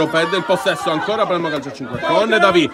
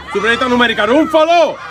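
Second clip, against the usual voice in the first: excited attack call; 4 semitones higher, 5 dB louder, faster than usual. Real, not acted. Attack, live commentary.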